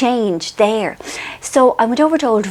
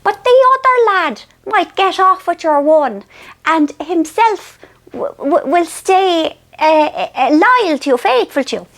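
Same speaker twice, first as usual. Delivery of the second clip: much higher, wise Irish mammy voice; mother soothing Marion, high and knowing; wise old mother; 7 semitones higher than usual. Retold or imitated. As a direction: deliver it like a sage old mother soothing her daughter. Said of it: "Mam also said".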